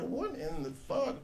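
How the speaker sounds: soft, slow, half-laughing disbelief; stunned mutter. Retold or imitated. Comic quoting himself stunned by the strange boy. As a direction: mutter it slowly, baffled and amused. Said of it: "And I'm like".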